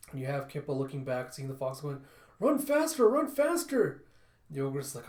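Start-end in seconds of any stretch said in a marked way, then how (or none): 2.4-4.0 very high, loud frantic urging; panicked shout. A narrator's reading. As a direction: scream at your carrier in terror. Kippa fleeing foxes.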